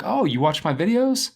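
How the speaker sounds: mock-cocky "oh, you're a fan?"; kinda cocky. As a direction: retell it with a pleased, self-important lift. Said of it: "probably sounding kinda cocky".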